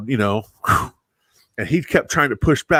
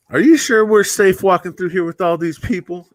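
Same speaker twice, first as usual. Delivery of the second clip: worried mom; 6 semitones higher than usual. Retold or imitated. Mom voiced in a story.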